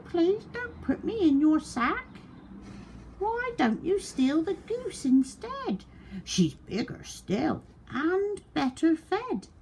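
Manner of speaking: very high quacking duck begging; high pleading duck. A narrator's reading. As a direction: save yourself, pitch it high.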